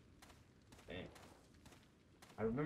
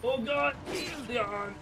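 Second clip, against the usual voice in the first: frantic angry yell; 9 semitones higher than usual. Real, not acted. Frustrated at stuck character.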